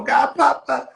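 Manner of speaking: a hint of a little girl calling out; goddaughter's voice. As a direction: mimic a little kid calling her godfather. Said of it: "My goddaughter go".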